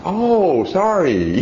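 high, sing-song fake sorry; mock the idea the kid would apologize; sarcastic mock apology. Preacher mocking a kicking child's apology. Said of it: "You think".

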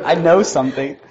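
teasing laugh-filled boast; giggling. Retold or imitated. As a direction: giggle it out, barely holding the secret in. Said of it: "I can't stop laughing".

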